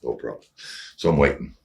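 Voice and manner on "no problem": low, quiet, holding it together; composed after deep breaths. Retold or imitated. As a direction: say it softly and low, keeping your cool. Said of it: "I took two breaths".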